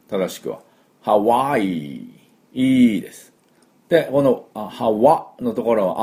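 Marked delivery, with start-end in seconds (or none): 1.0-2.0 ハワイ with the first syllable drawn out; long ハワイ. Shown as a demonstration. Teacher demonstrating a slow, stretched ハワイ. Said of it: "ハワイってちょっと伸ばすんですね".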